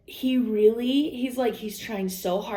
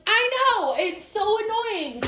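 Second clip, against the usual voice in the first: much higher, whiny mock voice; whiny high complaint; 12 semitones higher, slower than usual. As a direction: whine it like a frustrated kid. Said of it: "I'm like".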